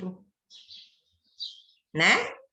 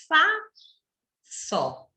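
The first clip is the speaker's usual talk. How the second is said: split into two pieces; 7 semitones higher than usual. Shown as a demonstration. Teacher showing two pedacinhos, FA and SÓ said as separate syllables. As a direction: break it in two: FA, then SÓ. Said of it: "ela possui dois pedacinhos".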